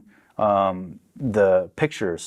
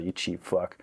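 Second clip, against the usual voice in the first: much softer, under the breath; muttered; 3 semitones lower, 9 dB quieter than usual. Retold or imitated. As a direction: say it to yourself. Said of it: "I thought".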